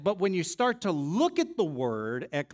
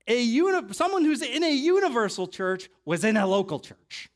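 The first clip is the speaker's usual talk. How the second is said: raised, louder preacher's voice; emphatic preaching; 6 semitones higher, 6 dB louder than usual. Real, not acted. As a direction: drive the point home from the pulpit, high and forceful. Preacher drives the point home.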